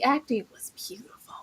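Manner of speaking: hushed whisper of praise; whispered awe. Real, not acted. Cohost praising the episode's acting, whispered awe.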